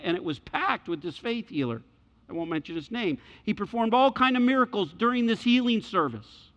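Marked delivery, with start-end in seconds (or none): 3.4-6.5 rising preaching. Acted. Preacher building the healing arena scene.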